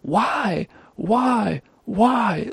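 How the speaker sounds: high, soft mock scream; mimicked scream, held back in volume; retold scream. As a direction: wail it high without raising the volume. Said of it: "I'm screaming".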